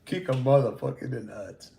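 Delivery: lightly imitating the swaggering intro; a hint of the tough-guy voice; mock tough guy. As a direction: quote the clip through laughter. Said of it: "I still laugh at that shit".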